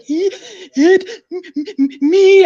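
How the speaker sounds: high, loud re-enacted hysterical stutter; freaked-out stammer. Terrified girl telling her dad on the phone, hysterical stammer. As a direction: choke out each word in broken sobbing bursts. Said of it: "I was, like, freaked the fuck out".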